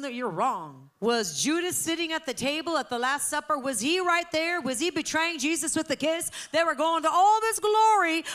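loud, high rhetorical questions fired at the room; full-force preaching. Real, not acted. Preacher challenging the congregation about spirits and Judas, impassioned. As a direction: preach it hard, building to a loud peak.

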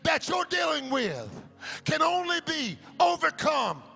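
high, ringing sermon climb to the last line; preaching at full force. Acted. Pastor declaring Jesus's answer, full force.

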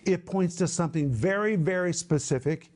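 ordinary delivery

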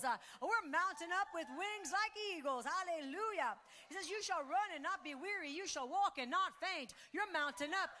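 loud, high, fervent preaching; preaching at full force. Acted. Pastor rallying the church to mount up, loud fervent preaching. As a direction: lift the room with every line.

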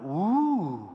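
long swooping woo; rising and falling "ooh"; startled "ooh". Retold or imitated. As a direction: imitate a friend's drawn-out woo of surprise. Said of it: "her friend went".

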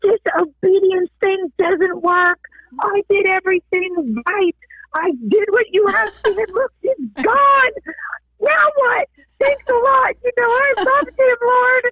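high, tearful, wailing complaint in a re-enacted fit; crying, pleading outburst, acted out; angry at God. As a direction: throw a tearful tantrum at God, pitched very high. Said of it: "I was so angry with God".

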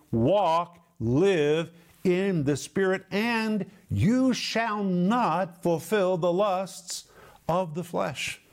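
slow, weighted declaration, each key word set apart; emphatic and categorical. Acted. Preacher quoting Paul's command to walk in the Spirit, emphatic. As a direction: proclaim it slowly, leaving no room for doubt. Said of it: "Paul says it emphatically and categorically".

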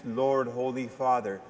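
ordinary talk

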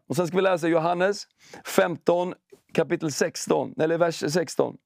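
ordinary delivery

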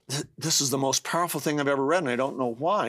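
normal talking voice

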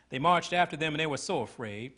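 ordinary delivery

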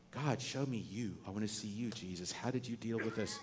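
quiet, intimate plea to God; hushed and earnest, like praying alone; softly voiced prayer. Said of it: "prays as an example".

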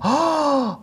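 high delighted "Åh!"; surprised gasp. Retold or imitated. Flight attendant smelling the oil, gasping high in delight. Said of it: "luktade hon på den och bara".

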